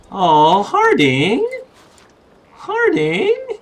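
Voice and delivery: very high cooing aww; gushing affection. Real, not acted. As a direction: coo over someone adorable. Gushing over the touching letter.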